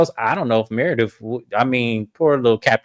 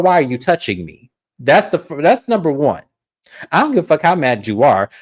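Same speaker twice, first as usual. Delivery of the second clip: ordinary delivery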